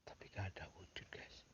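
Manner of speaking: faint voiceless whisper. Real, not acted. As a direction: barely whisper it. Hiding at night, hearing noises.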